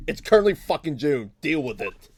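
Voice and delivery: normal talking voice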